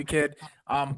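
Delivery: usual speaking voice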